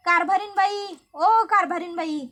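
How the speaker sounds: high, loud calling. Acted. Dushyant arrives shouting for his wife, loud call. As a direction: call her from across the yard.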